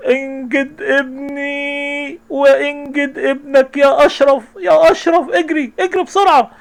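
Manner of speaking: high, drawn-out frantic cry for help; mother screaming at the father to save her son, frantic; panicked mother screaming. Acted. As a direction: beg the father to run and save the boy. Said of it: "الأم تصرخ".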